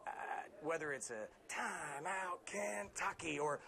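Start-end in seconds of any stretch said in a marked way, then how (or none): 1.5-3.4 slow, high PA announcer call; drawn-out timeout call to needle the visitors; arena announcer voice. Shown as a demonstration. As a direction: stretch each word out for the arena. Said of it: "his arena voice takes over".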